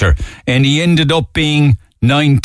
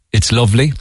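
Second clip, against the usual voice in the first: ordinary delivery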